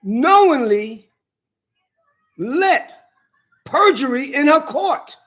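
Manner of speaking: each word laid down slowly; measured, pointed charge; slow, deliberate accusation. Real, not acted. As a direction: spell out willingly, knowingly slowly. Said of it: "I have the right to say".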